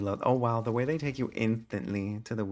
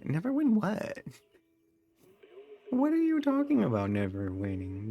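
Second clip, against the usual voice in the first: mock indignation; 11 semitones higher than usual. Real, not acted. Indignant reply to chat.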